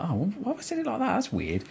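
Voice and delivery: high, soft retold bemusement; puzzled self-talk. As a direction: imitate wondering at your own slip. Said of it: "and I was like".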